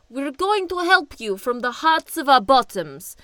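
mock-earnest Ivan misquoting the phrase; Ivan's voice. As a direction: deliver the garbled line proudly, in character. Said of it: "Ivan expresses this by saying".

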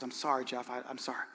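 soft, low retold apology; hushed, regretful voice; subdued condolence. Consoling man after final divorce, retold.

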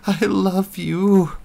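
high, loud, slow anguished cry. Acted. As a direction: wail it out, drawn out and breaking. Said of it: "Melinda cried in Eric's mind".

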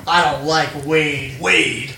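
slow, drawn-out cheesy villain; eighties movie villain. Retold or imitated. Mocking the line as a cheesy eighties villain, slow. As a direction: savor each word like a B-movie heavy. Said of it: "the villain in, like, a bad eighties action movie".

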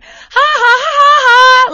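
loud, high hammed-up laugh; a hammed-up prank-show host cackling; mock prank laugh. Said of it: "Ashton gonna jump out and be like".

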